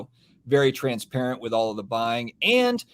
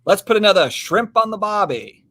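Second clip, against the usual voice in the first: hammed-up Australian accent, higher and louder; bad Aussie accent; 7 semitones higher, 7 dB louder than usual. Streamer greets an Australian viewer in a hammed-up Aussie accent. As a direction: put on a cheesy Aussie accent. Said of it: "the best Aussie accent I can come up with".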